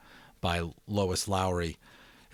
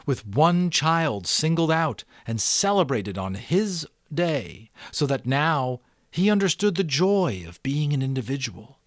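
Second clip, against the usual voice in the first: expressive read-aloud narration; story narration; 6 semitones higher, 6 dB louder than usual. A narrator's reading. Narrator reading The Giver to subscribers, expressive storytelling.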